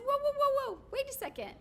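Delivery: alarmed objection. Retold or imitated. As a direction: halt the handoff, alarmed. Challenging ballot handoff inside.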